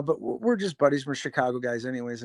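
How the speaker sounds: ordinary talk, nothing marked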